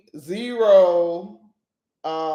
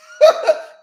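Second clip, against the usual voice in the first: a sudden high burst of laughter at his own joke; loud self-amused laugh; 9 dB louder than usual. Real, not acted. Host laughing at his own point, loud high laugh. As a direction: laugh out loud, tickled by yourself. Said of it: "now that I've tickled my own fancy".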